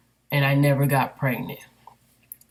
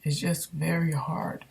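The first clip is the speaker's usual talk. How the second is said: holding back tears. Real, not acted. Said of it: "I'm trying not to cry".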